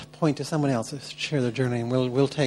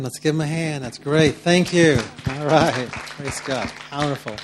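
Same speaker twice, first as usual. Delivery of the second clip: rallying applause; 2 semitones higher, 7 dB louder than usual. Real, not acted. Leader calls the group to applaud the sharer, excited and loud. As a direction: pump the room up for applause.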